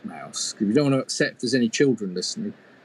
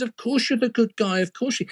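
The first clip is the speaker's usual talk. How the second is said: higher, soothing grandmother; a light touch of hurried comfort; mock reassuring granny; 8 semitones higher than usual. A narrator's reading.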